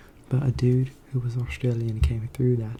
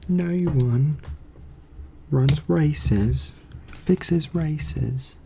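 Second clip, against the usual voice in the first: British accent; 3 semitones higher, slower than usual. Shown as a demonstration. Streamer showing off an accent to viewers, British accent. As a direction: declare it in a British accent, phrase by phrase. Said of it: "there's that British accent".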